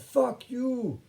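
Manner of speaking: high, loud drawn-out yell; shouting back. Retold or imitated. Cyclist shouting back while riding away, high angry yell. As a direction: imitate an angry shout from down the road. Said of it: "he shouts back".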